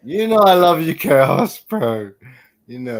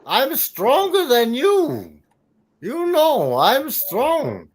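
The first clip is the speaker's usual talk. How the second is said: Iron Sheik; 6 semitones higher than usual. Acted. Wrestler boasting of strength to rivals, Iron Sheik voice. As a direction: boast in a hammed-up broken-English wrestler voice. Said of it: "I am Iron Sheik".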